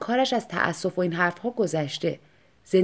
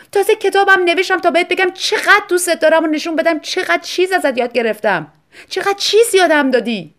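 higher, louder wife pouring out her heart; a wife pouring out her feelings, higher and louder; impassioned wife; 8 semitones higher, 8 dB louder than usual. Acted. Wife figure's long outburst. (زن با شور و هیجان، صدای زیرتر و بلندتر)

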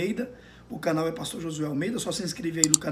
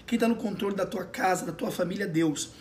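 ordinary talk, nothing marked

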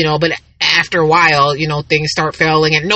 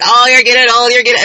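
higher, louder-edged imitation of nagging teasing; mock teasing; 5 semitones higher, 4 dB louder than usual. Sister always on about her age, mock teasing. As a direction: needle them with a repeated jibe.

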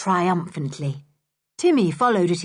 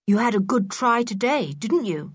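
ordinary delivery, nothing marked

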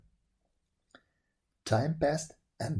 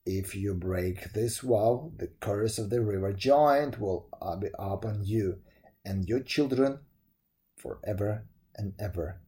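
deep solemn giant; 5 semitones lower than usual. A narrator's reading. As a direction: pronounce the curse gravely from the river bed. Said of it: "said the deep voice solemnly".

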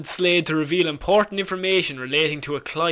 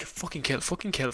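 soft, low egging on; quiet urging; 4 semitones lower, 11 dB quieter than usual. Retold or imitated. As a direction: mutter it low and eager. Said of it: "she's like".

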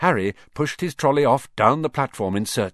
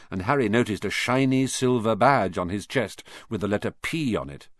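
ordinary delivery, nothing marked

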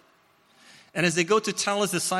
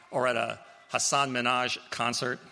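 ordinary delivery

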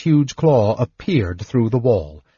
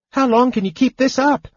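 high-pitched urgent shout; 13 semitones higher than usual. A narrator's reading. Kender shouting to old mage while running, urgent. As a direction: call it out in a high, strained kender voice. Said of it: "he shouted to Fizban".

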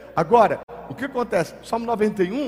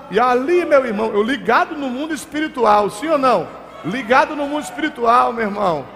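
loud, high, fervent preaching; fiery preaching; 7 semitones higher, 6 dB louder than usual. Acted. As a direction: preach it at full force. Preaching at full force. (pregação fervorosa em alta voz)

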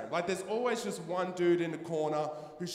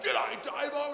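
higher, shouted bloke g'day; gruff group greeting; 10 semitones higher, slower than usual. Retold or imitated. Five blokes around a bush fire greet him, shouting. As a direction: bellow it like a gang of blokes.

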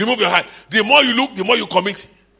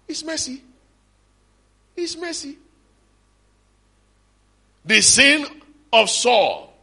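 usual speaking voice

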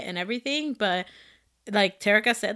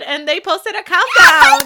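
excited shout; 10 semitones higher, 11 dB louder than usual. Real, not acted. Telling friends about the countdown, excited laughing shout. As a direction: voice jumps up, loud and laughing.

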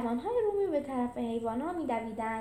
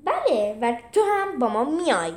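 firm and louder; firmly; 9 dB louder than usual. Acted. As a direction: lay down the law to her. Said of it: "جولیوس محکم گفت".

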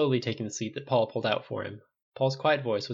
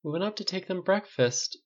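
higher-pitched, helpless excuse; hopeless; 9 semitones higher than usual. A narrator's reading. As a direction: begin an excuse you know is hopeless. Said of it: "Riley began hopelessly".

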